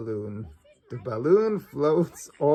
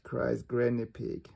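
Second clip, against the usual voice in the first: ordinary delivery, nothing marked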